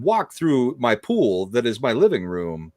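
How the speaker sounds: halting celebrity impression with swooping pitch; odd surges of pitch mid-phrase, dropping at the ends; Christopher Walken. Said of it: "a lot about your walking".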